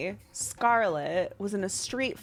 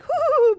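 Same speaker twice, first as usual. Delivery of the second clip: high loud whoo; a big impressed whoo; 9 dB louder than usual. Retold or imitated.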